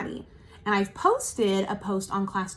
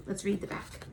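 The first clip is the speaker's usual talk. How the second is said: ordinary talk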